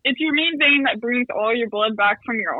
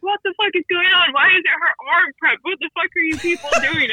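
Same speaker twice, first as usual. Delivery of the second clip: higher-pitched imitation of an angry doctor; mock exasperated telling-off, pitched up; retold yelling; 6 semitones higher than usual. Doctor scolding the staff over the wrong prep, retold yelling. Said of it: "he's like yelling at my buddy".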